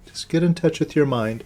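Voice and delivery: measured meditation guidance with long silences and falling endings; guided meditation. Real, not acted. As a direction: lead them inward, one short instruction at a time.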